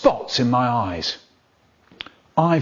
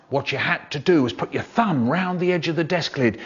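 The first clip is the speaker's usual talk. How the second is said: ordinary delivery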